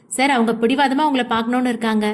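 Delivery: usual speaking voice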